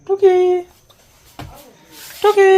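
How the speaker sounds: high playful peekaboo; high peekaboo call. Pretend. Peekaboo game with toddler.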